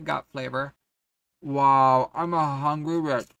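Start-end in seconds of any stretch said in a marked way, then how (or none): 1.4-3.3 loud exclaim. Real, not acted. Exclaims over chips.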